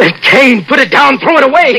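high, loud panicked demands; terrified pleading. Acted. Danny begging Martha to throw away the cane, panicked shout. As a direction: beg her to drop it, near hysteria. Terrified husband begs wife.

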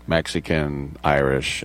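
ordinary talk

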